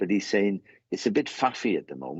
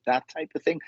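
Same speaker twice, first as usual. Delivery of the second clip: normal talking voice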